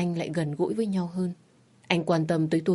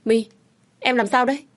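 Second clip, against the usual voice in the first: anxious husband, higher and louder; worried; 7 semitones higher, 5 dB louder than usual. A narrator's reading. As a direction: rush in alarmed at her bleeding hand. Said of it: "chụp lấy bàn tay tôi lo lắng".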